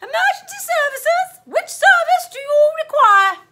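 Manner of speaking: very high, loud operator voice; high-pitched switchboard greeting; unusually high-pitched. A narrator's reading. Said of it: "came in an unusually high-pitched tone".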